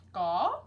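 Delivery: short loud bird squawk; a loud questioning penguin call. Acted. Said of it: "said the penguin".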